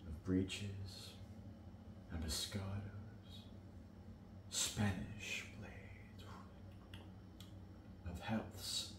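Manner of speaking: low, soft, halting delivery; hushed soldier's dream. Acted. Mercutio describing a soldier's dreams, hushed and halting. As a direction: sink low and quiet, pausing between images.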